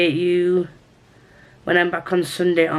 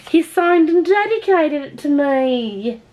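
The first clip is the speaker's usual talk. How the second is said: delighted; 8 semitones higher, slower than usual. Real, not acted. Thrilled at signed book.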